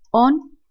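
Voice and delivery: "on" lifted with a rising tune; rhyme sound pointed out. Shown as a demonstration. Teacher showing the second rhyme, rising "on". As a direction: raise "on" as you point it out. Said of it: "Vemos aquí que dice".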